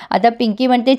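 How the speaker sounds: plain reading voice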